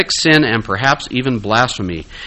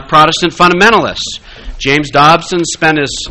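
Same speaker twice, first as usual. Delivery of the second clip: ordinary talk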